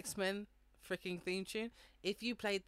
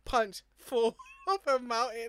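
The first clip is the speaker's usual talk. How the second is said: laughing; 5 semitones higher, 9 dB louder, slower than usual. Retold or imitated. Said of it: "He just goes".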